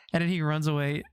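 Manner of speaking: ordinary delivery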